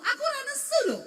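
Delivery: loud, high, fast protest; hammed-up indignant outburst; retold angry denial. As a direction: deny being angry while sounding angry. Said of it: "malah jadi nesu tenanan".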